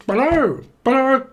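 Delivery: loud, high squawked hello; comic piercing greeting; mock phone hello. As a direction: squawk hello down a bad line. Caller on bad line.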